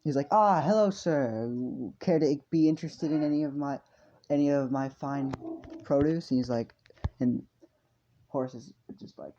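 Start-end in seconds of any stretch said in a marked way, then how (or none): 0.3-6.3 loud, higher-pitched merchant greeting; hammed-up sales pitch; eager market seller. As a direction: hail a customer like a keen stallholder. Stall seller greets a customer.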